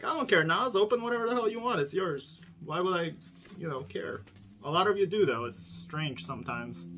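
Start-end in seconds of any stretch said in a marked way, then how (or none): 0.0-4.2 mock viewer voice. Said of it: "It's like".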